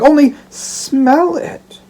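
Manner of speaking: drawn out and high, coaxing; playful teasing plea. Acted. Daughter coaxing her father to smell, playful and slow. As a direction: tease him into smelling it slowly.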